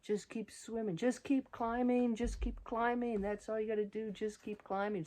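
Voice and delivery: high-pitched cartoon fish pep talk; lilting, high-voiced repeated refrain; Dory the fish. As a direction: chant it high, like a cheerful self-motivating mantra. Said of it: "all I kept thinking about was Dory the fish".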